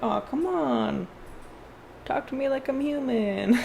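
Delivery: slow, high, lightly voiced pet complaining; a pet whining gently to be talked to like a person; pet's plea. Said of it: "they're like".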